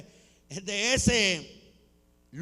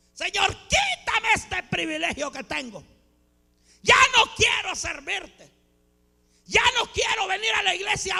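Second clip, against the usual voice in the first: despairing protest; 9 semitones higher than usual. Acted. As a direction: cry out as if fed up with serving. Acts out despairing complaint to God. (queja desesperada, fingida, a gritos)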